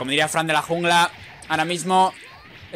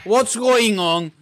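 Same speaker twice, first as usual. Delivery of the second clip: high, loud, slow put-on English; mock English; 4 semitones higher, 4 dB louder, much slower than usual. Gamer repeating himself in English for bilinguals, mock. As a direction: stretch it out as a joke translation. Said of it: "Para los bilingües".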